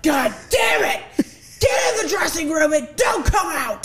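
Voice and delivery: furious boss yell, loud and high; angry shout. Retold or imitated. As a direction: bark the order like an enraged club manager. Boss yelling at tripping dancer.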